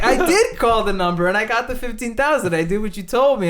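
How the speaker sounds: loud, high, earnest mock insistence; hammed-up innocence to the baffled scammer; insisting innocently. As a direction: play dumb with conviction. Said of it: "And I was like".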